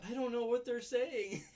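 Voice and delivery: loud, high imitation of a baffled girl; mock exasperated wail. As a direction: wail it out, half laughing. Voices girl stuck with nerds.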